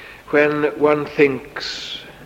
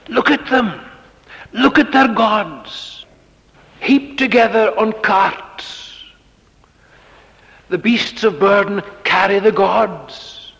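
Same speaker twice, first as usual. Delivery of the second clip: heavy sarcasm, voice lifted in scorn; scornful mockery; 7 semitones higher than usual. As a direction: point at the idols and scoff. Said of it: "the sarcasm, as I say here, is very heavy".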